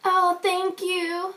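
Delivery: high, soft, slow sarcastic thank-you; a hint of a fake-polite clerk; mocking the teller's slow, soft thank-you; mock sweet thanks. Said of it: "the teller bitch then decides to say".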